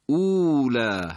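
اولا slow, with the first vowel drawn long; lengthened vowel. Shown as a demonstration. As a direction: stretch the opening of اولا. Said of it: "همیشه کشیده هست".

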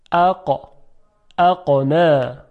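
اقا and اقنا with the ق cutting the sound off; hard qaf stop. Shown as a demonstration. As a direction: stop the breath sharply on ق in اقا.

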